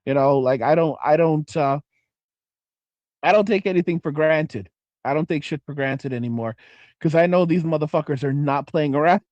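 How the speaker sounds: ordinary talk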